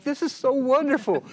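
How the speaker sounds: high, loud gushing delight; retold elation. Family hugging the ticket giver, elated. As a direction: imitate someone bursting with gratitude.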